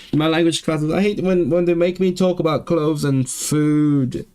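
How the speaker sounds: whiny complaint. Real, not acted. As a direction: whine it out, stretching the last words. Complains about boring class topic.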